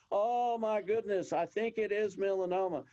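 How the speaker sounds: giddy excited doctor. Retold or imitated. Doctor running around giddy over finding melanoma, excited. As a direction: gush like he just won the lottery. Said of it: "the guy just started getting all excited".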